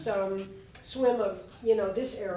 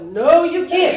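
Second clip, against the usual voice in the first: high, loud, laughing gospel call; church-revival response, half laughing; revival preacher; 7 semitones higher, 13 dB louder, slower than usual. Retold or imitated. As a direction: shout it like a preacher at a tent revival. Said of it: "Pauline's like at the revival".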